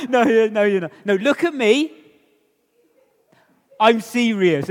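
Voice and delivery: put-on teasing kid. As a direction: tease dad that he can't be serious. Kid mocks dad's warning.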